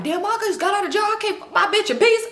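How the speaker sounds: high-pitched imitated griping; loud complaining. Cousin's baby daddy gripes loud from inside, high imitation. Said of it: "the cousin baby daddy in there loud".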